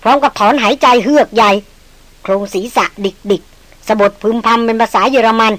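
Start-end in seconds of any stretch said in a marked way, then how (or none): none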